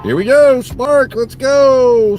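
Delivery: high, loud hype-up cheer; excited cheer. Real, not acted. Driver hyping himself for a Spark order, excited high cheer. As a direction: pump yourself up for the next order.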